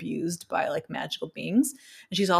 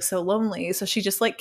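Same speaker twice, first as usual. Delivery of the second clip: ordinary delivery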